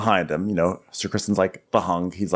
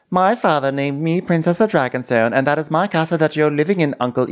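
higher, declaimed royal claim; haughty princess; 5 semitones higher than usual. Acted. Princess confronting her uncle, haughty. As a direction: announce it high, pulling rank. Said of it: "So then she's like".

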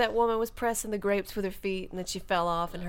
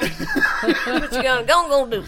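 Creole accent; 3 semitones higher, 5 dB louder than usual. Shown as a demonstration. Demonstrating the secret to a good Creole accent. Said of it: "the secret to a good Creole accent".

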